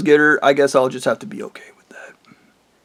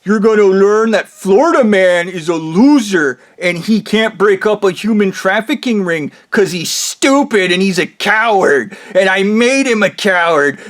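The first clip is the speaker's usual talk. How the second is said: raised, loud, emphatic taunt; mocking Blondie; 4 semitones higher, 4 dB louder than usual. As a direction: hammer out each insult in a higher voice. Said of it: "Blondie then goes".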